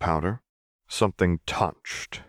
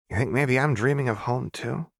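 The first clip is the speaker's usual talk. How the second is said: put-on higher, lighter voice of a strange young girl; 8 semitones higher than usual. Narrator voicing Jinx, insistent young girl. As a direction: voice the girl insisting she knows her.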